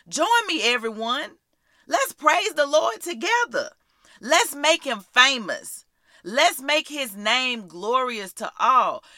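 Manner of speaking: high-pitched, exhorting proclamation; rallying praise. Shown as a demonstration. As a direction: call the whole family to praise. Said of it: "this is how we should be talking".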